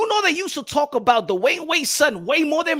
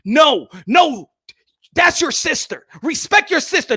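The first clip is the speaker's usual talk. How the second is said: stern father; 4 semitones higher, 4 dB louder than usual. Acted. Acted father scolding son.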